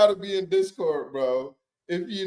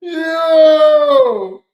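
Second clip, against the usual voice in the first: loud, high shocked yell; real loud shocked yell; shouted yo; 11 semitones higher, 9 dB louder than usual. Real, not acted. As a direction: yell it out in disbelief. Stunned reaction on stream.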